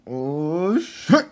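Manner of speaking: rising, loud excited exclaim; surprised shout. Real, not acted. Vlogger spotting Cactus Jack design, loud and rising. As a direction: react with a jolt.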